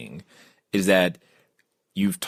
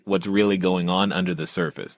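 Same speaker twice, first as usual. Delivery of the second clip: ordinary talk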